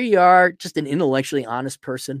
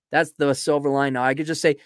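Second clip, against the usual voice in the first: ordinary talk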